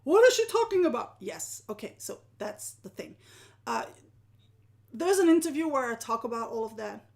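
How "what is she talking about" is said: high, loud burst of puzzlement; baffled viewer. Acted. Viewer thrown by the wheelchair story, baffled. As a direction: act out a confused listener, quick and pitched up. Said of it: "some of you might, might be like".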